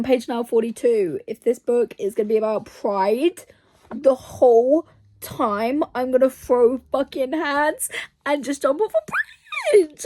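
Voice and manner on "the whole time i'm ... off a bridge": slow, dragged-out rant climbing high; exasperated. Real, not acted. Reader dreading a book about pride, exasperated. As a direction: build to a high wail of frustration.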